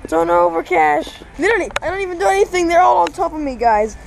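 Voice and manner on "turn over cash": calling the dog loudly; firm, raised command. Real, not acted.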